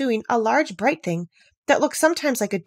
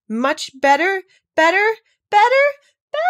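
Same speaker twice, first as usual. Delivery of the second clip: each BETTER higher and louder, up to a squeak; rising to squeak; 11 semitones higher, 7 dB louder than usual. A narrator's reading. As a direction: climb from a high cry to a squeaky bleat. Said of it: "her voice rising to a squeak".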